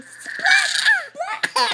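very high sharp splat; lightning splat. Acted. Reading to a child, cracking like lightning. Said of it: "He makes lightning".

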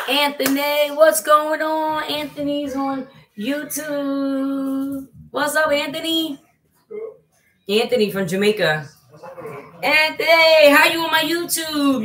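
high, loud, slow drawn-out hello; excited greeting. Real, not acted. Greeting her friend Anthony on YouTube, excited. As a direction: call out to a friend joyfully.

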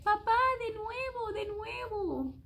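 put-on high excited little child begging; child's plea. A narrator's reading. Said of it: "le dice".

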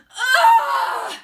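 high, very loud groan of disgust; disgusted yell. Acted. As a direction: yell out in revulsion. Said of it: "yelled Andrew".

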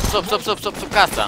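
high, rapid repeated callout; urgent callout. Real, not acted. Gamer warning teammates an enemy is under the house, urgent high callout. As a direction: warn the team fast, pitch up.

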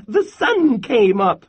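high, loud chirpy squirrel cry; chirped. A narrator's reading. Happy squirrel chirps at Bear, high and loud. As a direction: chirp it out with delight. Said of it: "he chirped".

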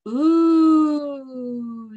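loud rising ooh of delight. Shown as a demonstration. Teacher showing kids the circus food sound, a loud delighted ooh. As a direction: ooh like you can't wait to taste it. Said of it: "you can make this sound".

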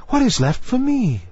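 high, plaintive mermaid voice; small high-voiced plea; pleading little mermaid. A narrator's reading.

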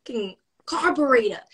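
put-on a light New York accent as Joan Rivers, exasperated rant. The speaker retells Joan's excuse to Jimmy, in a light Joan Rivers accent. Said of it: "with the accent and everything".

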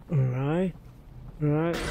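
normal talking voice

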